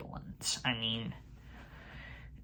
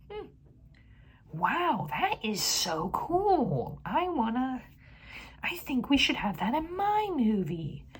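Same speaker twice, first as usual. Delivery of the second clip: mock awed filmmaker; 11 semitones higher than usual. Reviewer imitating the director falling for the idea, in a high dopey awed voice. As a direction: play a director dazzled by a dumb idea.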